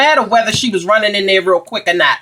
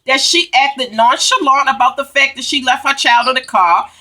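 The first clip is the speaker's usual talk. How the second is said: normal talking voice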